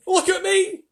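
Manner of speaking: very high, loud cry. A narrator's reading. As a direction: shout for attention like a gleeful centipede. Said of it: "cried the centipede".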